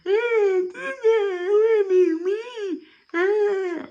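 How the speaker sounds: high, slow, whiny blubbering; mock crying kid. Kids crying in the back, mock high slow blubbering. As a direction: wail like a scared kid denying it. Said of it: "in the back crying".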